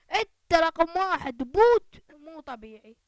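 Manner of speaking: loud triumphant shout, the last part stretched slow; excited yell. Real, not acted. Gamer yelling at a downed opponent, triumphant shout. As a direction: yell it like you just won. Hyped after a kill.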